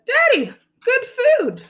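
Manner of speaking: put-on high, slow child voice; high-pitched, drawn-out delight; eager little girl. A narrator's reading. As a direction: announce it proudly like a small kid. Child proudly shows father food.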